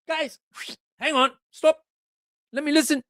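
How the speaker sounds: high, urgent Solomon halting the march; Travis impersonation. Acted. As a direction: hold the troops up, high and tense. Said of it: "in impersonation".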